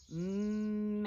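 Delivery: MESS with long hummed m and long s; said slowly. Shown as a demonstration. Teacher sounding out a word, slowly. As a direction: stretch "Mess" from humming m to hiss. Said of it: "Say it slowly".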